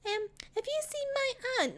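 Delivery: put-on a small lost girl greeting strangers; timid little girl. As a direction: ask shyly like a little girl. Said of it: "she actually went always like".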